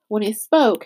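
ordinary delivery, nothing marked

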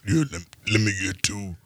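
imitating a friend's low, deep voice. Mimicking deep-voiced friend ordering two beef patties, deep mock voice. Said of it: "Red's voice was deep from grade seven".

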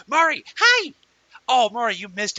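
high, loud, delighted hello; light up as a friend walks in; excited greeting. Real, not acted. Greeting a friend who just arrived.

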